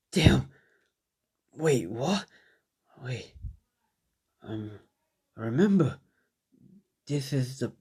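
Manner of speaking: halting, hurt hero with long pauses; injured. Acted. Narrator voices injured Deku finding himself back in time, pained. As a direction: act winded, groaning between broken phrases.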